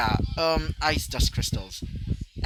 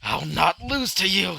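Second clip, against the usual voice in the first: slow, louder, higher defiant vow; mild raging vow; 5 semitones higher, 4 dB louder, slower than usual. Acted. Furious rival charging his opponent, slow loud vow. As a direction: grit it out, refusing to lose. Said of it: "like, raging as he would say".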